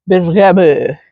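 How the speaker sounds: bergamot said the French way, ending on an open -mo with no final t; Frenchify bergamot as ber-ga-MO; French-style bergamot. Shown as a demonstration. Gardener trying the French way to say bergamot, mock French. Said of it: "say it French like bergamot".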